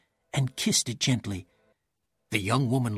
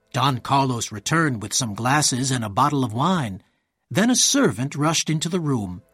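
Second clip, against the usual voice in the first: ordinary delivery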